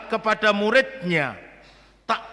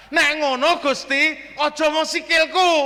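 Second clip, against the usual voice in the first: higher, eager Peter in Javanese; Peter giving in; 8 semitones higher, 4 dB louder than usual. Retold or imitated. Peter begs the Lord after his warning, higher and eager. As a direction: play Peter hastily changing his mind. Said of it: "Iki sifatnya Petrus ya gitu".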